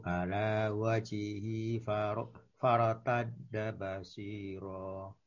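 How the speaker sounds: sounding far off, moving away; walking away. Real, not acted. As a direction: voice fading far from the mic. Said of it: "Suaranya makin menjauh ya".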